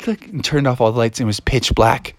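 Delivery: ordinary delivery, nothing marked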